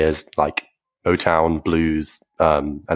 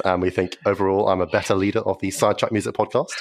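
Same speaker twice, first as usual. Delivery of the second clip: normal talking voice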